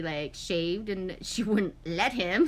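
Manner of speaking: usual speaking voice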